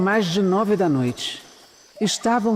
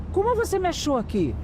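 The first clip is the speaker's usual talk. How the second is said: startled question; 11 semitones higher, 3 dB quieter, faster than usual. Acted. Surprised call from João.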